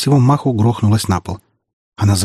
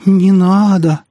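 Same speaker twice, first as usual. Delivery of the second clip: high, loud, drawn-out plea; frightened, pleading wail; plaintive moan; 9 semitones higher, 5 dB louder, much slower than usual. A narrator's reading. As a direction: moan it pitifully, stretching the words. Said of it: "жалобно простонал он".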